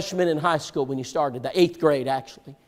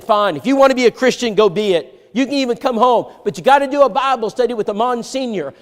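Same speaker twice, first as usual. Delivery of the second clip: louder and higher, grudging; retold gruff ultimatum; 7 semitones higher, 5 dB louder than usual. Father setting terms for his son, gruff retold ultimatum. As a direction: bark the terms out.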